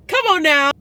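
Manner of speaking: high and loud, near tears; tearful joy. Real, not acted. Vlogger overcome with gratitude, tearful and high. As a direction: gush it out through happy tears.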